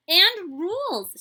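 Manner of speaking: loud, high, slow and eager; eager addition. Acted. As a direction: pitch the idea with excitement. Child adding eagerly.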